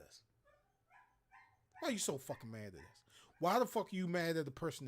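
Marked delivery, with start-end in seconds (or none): 1.8-3.0 much softer, quicker muttered repeat; quiet fast repeat. Real, not acted. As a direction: drop the voice and rush it under the breath.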